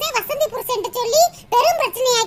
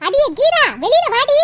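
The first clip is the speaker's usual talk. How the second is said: higher, louder yell for her to come out; 3 semitones higher, 5 dB louder than usual. Acted. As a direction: shout her out of the house. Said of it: "காட்டு கத்தா கத்திக்கிட்டு இருக்கோம்ல". (உரக்கக் கத்துதல்)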